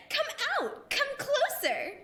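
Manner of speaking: high, sing-song coaxing with a giggle; luring with a laugh. Acted. Bubbly ghost coaxing the intruders closer, giggling. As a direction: lure them in, playful and creepy.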